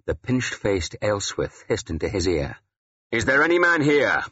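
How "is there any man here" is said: raised, high-pitched public challenge; shouted. A narrator's reading. Warrior challenging the congregation, shouting. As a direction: call out to challenge the whole room. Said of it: "I shouted".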